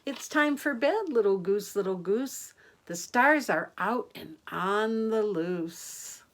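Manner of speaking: sing-song rhyming read, lifting on each line; bedtime rhyme. A narrator's reading. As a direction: lilt the rhyme to little ones. Reads bedtime rhyme to children.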